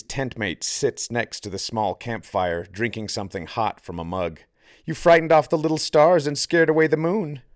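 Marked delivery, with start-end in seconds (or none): 4.9-7.4 teasing singsong, high and loud; mocking singsong. A narrator's reading. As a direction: sing it out to mock him. Victor continues mocking rhyme.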